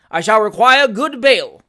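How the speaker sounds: high, very loud official pronouncement; aloud, dignified. A narrator's reading. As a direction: announce it to the whole court. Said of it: "said the magistrate aloud, with great dignity".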